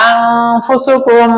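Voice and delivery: আনফুসুকুম drawn out, nasal hum on ন held long; slow recitation with ghunna. Shown as a demonstration. Teacher models আনফুসুকুম for student to repeat slowly. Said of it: "teacher recites, student repeats".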